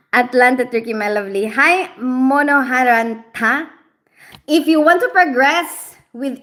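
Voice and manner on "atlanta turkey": normal talking voice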